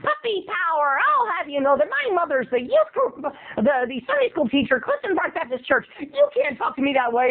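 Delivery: high, indignant little cartoon voice; high, self-righteous kid retort; Scrappy. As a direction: protest like a puffed-up cartoon pup. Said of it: "I sound like Scrappy on".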